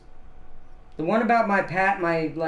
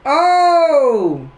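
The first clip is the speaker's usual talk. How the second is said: much higher, louder burst of recognition; sudden realization; 10 semitones higher, 10 dB louder than usual. Real, not acted. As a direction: it suddenly clicks. Suddenly remembers the talk.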